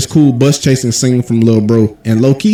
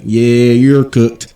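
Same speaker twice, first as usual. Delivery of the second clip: deadpan; much slower than usual. Retold or imitated. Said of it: "I was like".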